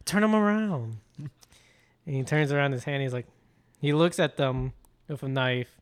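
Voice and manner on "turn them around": high, emphatic mock command; sharper insistent order. Tama pressing Andy about his hands, heightened insistence. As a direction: press the order harder, pitched up. Retold villain repeats the order.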